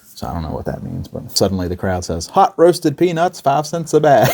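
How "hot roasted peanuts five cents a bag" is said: calling out like a peanut seller, higher and louder; mock vendor's cry; vendor hawking. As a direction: hawk peanuts to a crowd.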